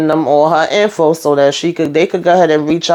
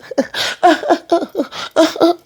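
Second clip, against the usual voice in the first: real laugh; 11 semitones higher than usual. Real, not acted. Laughs mid-story.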